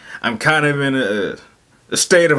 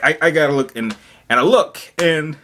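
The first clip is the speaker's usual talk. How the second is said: normal talking voice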